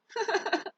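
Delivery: loud, high laugh breaking out; high burst of laughter. Real, not acted. Laughing at the pet after yelling at it.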